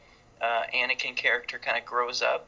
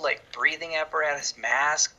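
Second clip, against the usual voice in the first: ordinary talk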